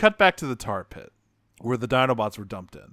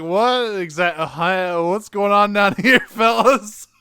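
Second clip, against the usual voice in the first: louder, higher awkward hello; mock bewildered greeting; 7 semitones higher, 7 dB louder than usual. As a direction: call down awkwardly, bemused. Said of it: "I was like".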